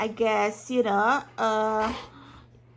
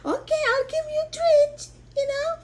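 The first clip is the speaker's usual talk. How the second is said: high pet voice; 12 semitones higher than usual. Real, not acted. Baby talk to dog.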